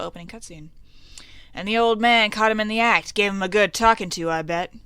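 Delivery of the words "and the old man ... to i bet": loud, higher start, settling at the end; acted townsman talking at full volume; hearty townsman. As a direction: chime in heartily with the gossip. Townsman guesses at a scolding.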